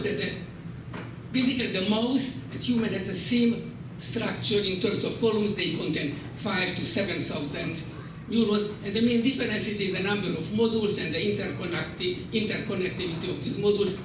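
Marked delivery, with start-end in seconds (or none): none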